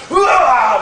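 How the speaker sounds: a sudden fake yell to sell the kick; loud faked battle shout; mock shout. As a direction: bark out a short feint cry. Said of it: "especially after you go".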